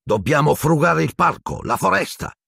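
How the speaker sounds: high, insistent executioner; angry snarl. A narrator's reading. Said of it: "ringhiò il boia". (ringhio rabbioso)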